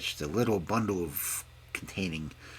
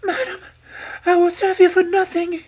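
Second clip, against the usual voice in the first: very high, pleading wail; desperate princess cry; 15 dB louder than usual. A narrator's reading. Princess begging to serve for nothing, crying out. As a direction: beg desperately as a poor princess. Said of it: "cried the poor princess".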